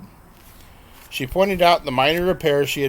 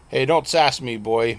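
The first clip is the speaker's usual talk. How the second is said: ordinary delivery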